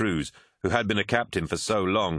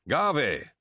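much higher call across a deck; a bright, raised call of a name; 9 semitones higher than usual. A narrator's reading. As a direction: hail someone from a distance. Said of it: "called a familiar voice".

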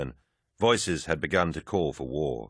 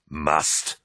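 roaring; 2 semitones lower than usual. A narrator's reading. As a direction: throw the word back at him. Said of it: "Horus roared".

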